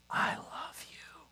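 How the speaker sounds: slow, tender whisper. Retold or imitated. Husband looks his wife in the eyes, slow whisper. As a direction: breathe it to her face to face.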